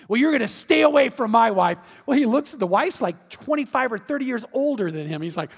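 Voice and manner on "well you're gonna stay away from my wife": loud threatening warning; jealous warning. Retold or imitated. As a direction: warn him off, louder. Jealous husband warning doctor.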